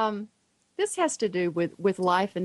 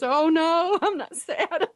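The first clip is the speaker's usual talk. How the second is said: high-pitched denial breaking into laughter; laughing child imitation; 9 semitones higher, slower than usual. Tearful son reassures his mother, high laughing imitation. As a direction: imitate a happy boy, laughing through the words. Son's happy-tears reply, told laughing.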